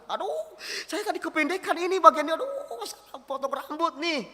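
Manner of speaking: high, hammed-up whine of dismay; regretful moan. Someone after a bad haircut, mock regretful moan. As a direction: moan it high, drawing out the aduh. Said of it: "Salah potong rambut itu penyesalan".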